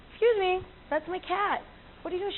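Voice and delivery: high-pitched, mock-polite challenge; polite confrontation. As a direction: question a stranger taking your cat. Acting out confronting the cat-taker.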